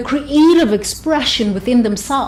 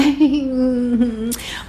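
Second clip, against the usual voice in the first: soft restrained little laugh, much quieter; polite quiet titter; 3 semitones higher, 5 dB quieter than usual. Shown as a demonstration. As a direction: titter politely over tea. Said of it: "you laugh like".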